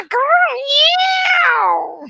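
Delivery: long, high wildcat cry; puma yowl. Pretend. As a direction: let out a big put-on cat yowl. Puma noise after saying her name.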